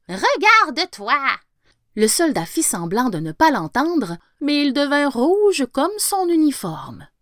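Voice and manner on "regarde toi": high mocking yell in a strange voice; jack-in-the-box yelling. A narrator's reading. Jack-in-the-box taunts the soldier, high mocking yell. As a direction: jeer it out at him. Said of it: "hurla-t-il".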